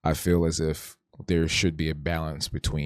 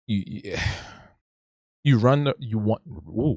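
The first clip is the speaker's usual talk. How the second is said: stumbling over the words; stuttering restarts; 4 semitones higher than usual. Real, not acted. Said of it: "wow, I'm stuttering".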